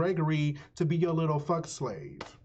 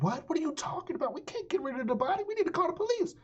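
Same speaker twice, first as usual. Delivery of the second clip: much higher, frantic protest; panicked disbelief; much faster than usual. Retold or imitated. Gregory protesting to Dev, high frantic panic. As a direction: squeak it out in alarm. Gregory shocked by body plan.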